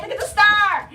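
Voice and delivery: high and loud, delighted; thrilled to hold up the star; excited. Real, not acted. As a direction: show off the toy with bright delight.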